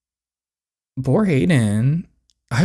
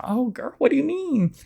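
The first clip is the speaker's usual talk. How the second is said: high pleading; 13 semitones higher than usual. Acted. Comforting a crying friend.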